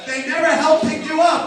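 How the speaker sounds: ordinary talk, nothing marked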